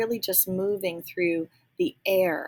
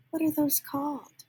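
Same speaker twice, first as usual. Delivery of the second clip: normal talking voice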